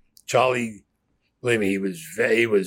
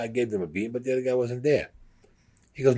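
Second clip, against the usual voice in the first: normal talking voice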